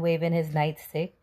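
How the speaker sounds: ordinary delivery, nothing marked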